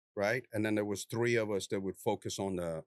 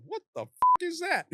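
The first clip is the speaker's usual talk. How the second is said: startled question; 6 dB louder than usual. Retold or imitated. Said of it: "he picks one up and he goes".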